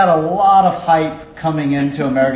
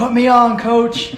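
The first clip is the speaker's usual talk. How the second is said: mock begging; 8 semitones higher, slower than usual. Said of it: "I started begging him".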